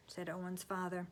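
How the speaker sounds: usual speaking voice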